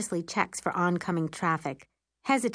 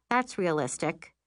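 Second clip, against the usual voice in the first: ordinary delivery, nothing marked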